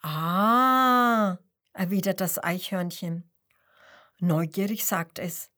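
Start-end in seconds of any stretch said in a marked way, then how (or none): 0.0-1.4 long, loud, drawn-out "Ah"; surprised realisation. Acted. Squirrel learning about French frogs, loud drawn-out Ah. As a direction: let it dawn on you.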